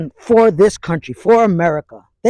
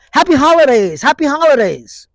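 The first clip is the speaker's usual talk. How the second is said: mock cheery, pitched up and louder; mocking singsong; 6 semitones higher, 4 dB louder than usual. Vlogger mocking the school's happy holidays greeting, mock cheery. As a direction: parrot it with a sneer.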